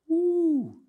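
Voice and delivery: high, loud whoop; excited whoop. Acted. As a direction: let out a delighted woo. Reacting to the rebuke.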